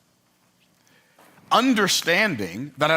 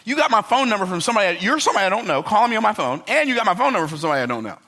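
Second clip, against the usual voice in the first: irritated; 6 semitones higher, faster than usual. Retold or imitated. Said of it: "Now I'm really irritated".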